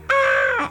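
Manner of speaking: short imitated kid's yelp; a little boy acting out his scream; mock startled scream. Little brother showing mom his scream, short yelp. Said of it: "I was like".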